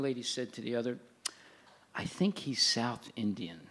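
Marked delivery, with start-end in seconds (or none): 1.9-3.6 low, soft imitation of a murmured remark; hushed retold aside. Lady to lady during a speech, hushed retold aside. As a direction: quietly voice an audience member's aside.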